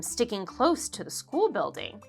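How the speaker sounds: ordinary delivery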